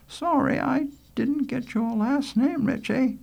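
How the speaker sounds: high, slow lady's voice; high-pitched, courteous teacher; polite schoolteacher Rose. Acted. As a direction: ask the boy kindly, high and unhurried.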